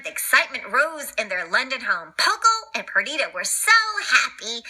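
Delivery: ordinary delivery, nothing marked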